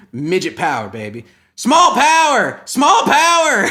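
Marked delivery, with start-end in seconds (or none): 1.6-3.7 high, loud drawn-out chant; slow, high-pitched hollering; mock rallying cry. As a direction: shout it like a comic rally slogan. Mock rallying chant for small people.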